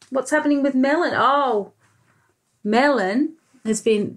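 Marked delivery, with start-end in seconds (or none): none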